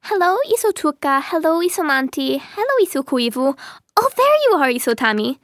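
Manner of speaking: high, singling out each one in greeting; a delighted little girl's high greetings, leaping up at the discovery; gleeful child. Acted.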